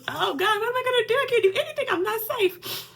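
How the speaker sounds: filming makeup, she breaks down crying; real breakdown. Real, not acted. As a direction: panic through real tears. Breaking down on camera.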